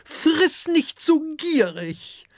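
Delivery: very high, slow dragon-wife voice; female dragon scolding. A narrator's reading. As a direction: nag in a high-pitched she-dragon voice. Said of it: "sagt eine Fressdrachin". (hohe, mahnende Drachinnenstimme)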